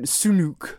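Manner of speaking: "Sunuk." with both vowels as oo, SOO-nook; Sunuk pronunciation. Shown as a demonstration. Correcting co-host's pronunciation, "Sunuk." said SOO-nook. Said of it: "No, Sun".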